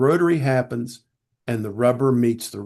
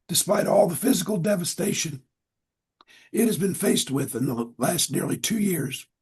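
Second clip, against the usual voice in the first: real strained voice giving out, pitched higher; losing voice; 5 semitones higher than usual. Real, not acted. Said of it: "I apologize for losing my voice".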